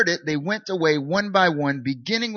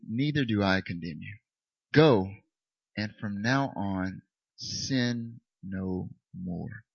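Jesus voice; 6 semitones lower, slower than usual. Acted. Jesus voice reading verse.

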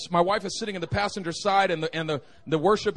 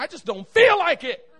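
loud, high comic protest; exasperated outburst; 9 semitones higher, 9 dB louder than usual. Acted. Preacher admitting he won't pray, loud exasperated protest. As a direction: blurt it out in mock exasperation. Confesses not wanting to pray.

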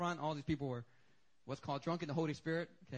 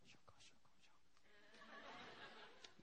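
breathy unvoiced chewing noise; imitated gum chewing; 2 semitones higher, 24 dB quieter than usual. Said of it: "He's chewing his gum".

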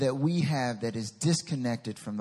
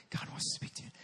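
soft, voiceless imitation; mock-reverent whisper; 12 dB quieter, faster than usual. Preacher mimics what many people say, hushed whisper. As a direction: whisper it like a precious secret. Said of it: "There's so many people say that".

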